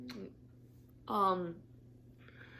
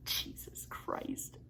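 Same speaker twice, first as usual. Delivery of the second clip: slow breathed-out whisper; exasperated whisper; 7 dB quieter, much slower than usual. Real, not acted. Whispering in exasperation at the cat on her back. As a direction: sigh it under your breath.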